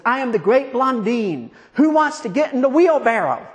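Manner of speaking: showman whipping up crowd. Acted. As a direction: work the crowd up, pitched high. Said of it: "Then he says".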